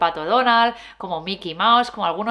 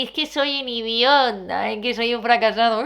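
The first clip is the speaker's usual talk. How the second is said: a hint of a whiny character; lightly voiced character. Shown as a demonstration. Demonstrating a character voice for negative thoughts. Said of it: "Para empezar a hablar así".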